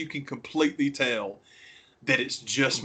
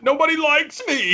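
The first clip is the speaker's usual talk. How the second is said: high, loud mock whine; whiny Trump; 7 dB louder than usual. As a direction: squeal it like a sulking child. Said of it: "It's like Trump the other day".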